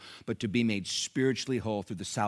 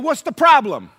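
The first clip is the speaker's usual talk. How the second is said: much higher, louder put-on voice; blunt challenger; 13 dB louder than usual. Fellow patient pressing Nelson, blunt and loud challenge. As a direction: demand an answer in a high acted voice. Same man confronting Nelson.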